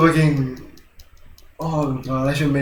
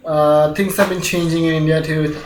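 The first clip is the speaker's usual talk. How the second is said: ordinary talk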